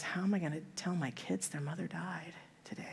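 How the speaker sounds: soft grieving whisper; barely able to get it out; broken whisper. Acted. Husband wonders how to tell his kids, whispered in grief. Widower after wife's death.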